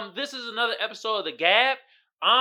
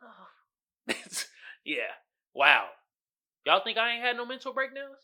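ordinary talk

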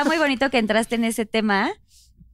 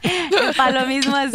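laughing; slower than usual. Real, not acted. Laughing at the joke.

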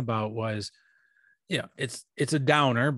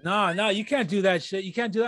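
mock shout; 7 semitones higher than usual. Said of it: "You were like".

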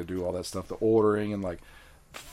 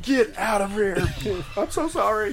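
high, slow anguished plea; hammed-up traumatized worker; mock horror; 12 semitones higher, 3 dB louder than usual. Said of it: "You'd be like".

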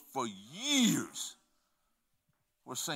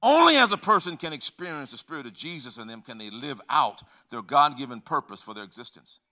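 ordinary talk

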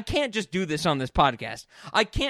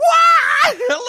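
shrieking laugh; 12 dB louder than usual. Real, not acted. Laughing at the clip.

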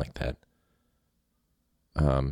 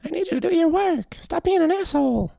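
very high, louder toy-bear voice; a talking teddy bear losing patience; teddy bear teacher snapping; Teddy Ruxpin voice; 4 dB louder than usual. Retold or imitated. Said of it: "what was the Teddy Ruxpin voice?".

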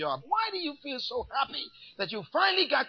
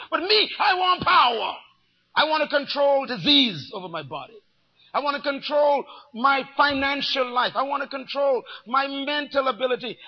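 loud, driving preacher proclamation; building shout of wanting power; forceful declaration; 3 semitones higher, 6 dB louder than usual. Acted. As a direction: pound out each I wanna. Preacher voicing power hunger.

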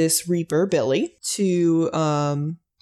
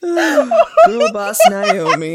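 high, drawn-out squeal through real laughter; laughing hard; 8 semitones higher, 8 dB louder, much slower than usual. Real, not acted. Fan gushing over a favorite character, helpless high-pitched laughter. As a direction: wail it slowly while cracking up.

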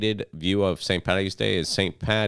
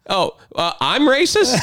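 much higher, louder disbelief; mock indignant; 8 dB louder than usual. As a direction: fire back in fake shock.

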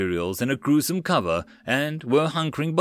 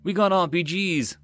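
barked back angrily; 6 semitones higher, slower than usual. Acted. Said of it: "Mills barked back angrily".

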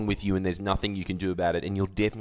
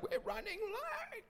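soft falsetto screech, drawn out; slow, squeezed mock scream; 14 dB quieter, slower than usual. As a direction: mimic screaming in a tiny, sky-high voice. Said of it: "and start screaming at you".

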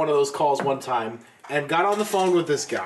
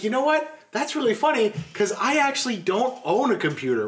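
mom's voice; 7 semitones higher than usual. Retold or imitated. Mom voiced in retold story.

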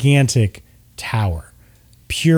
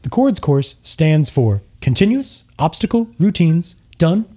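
higher, overexcited commercial pitch; cheesy half-elf ad; 5 semitones higher than usual. Acted. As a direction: sell it like a pushy TV ad host. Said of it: "And the half-elf says".

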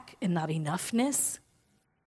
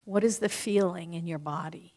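guided visualization; 2 semitones lower, 3 dB quieter than usual. Acted. Speaker guiding the audience through closing their eyes, softly.

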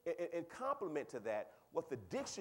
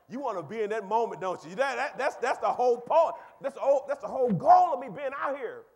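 much louder, laughing through the words; loud laughing preaching; 7 semitones higher, 14 dB louder than usual. Real, not acted. Preacher teasing the congregation, loud and laughing. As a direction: laugh and roar the point out.

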